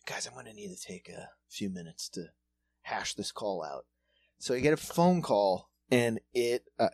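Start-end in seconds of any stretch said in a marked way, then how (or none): 0.1-3.8 whispered aside to coworkers. Retold or imitated. Telling his coworkers to give him minutes, whispering. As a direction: hush it to the guys at work. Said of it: "and I was like".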